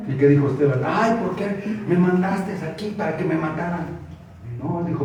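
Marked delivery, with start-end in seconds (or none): 0.9-3.9 higher-pitched whiny parody; mock complaint. Preacher parodies what Esteban never said, mock complaint. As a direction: whine it as a mock martyr.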